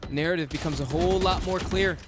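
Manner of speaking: high, hyped play-by-play call; esports caster. Acted. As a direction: ride the rising action like a caster. Casts the round live.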